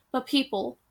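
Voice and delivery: ordinary delivery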